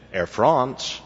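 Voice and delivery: Frahnce, then a Southern "can I help you"; proper French name sliding into Southern twang; Southern accent with French "France". Acted. Corrected receptionist answering the phone, French "France" then Southern accent. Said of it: "The same receptionist answered the phone".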